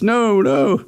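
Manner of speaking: high, scared refusal; frightened protest. Acted. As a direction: shrink back from it.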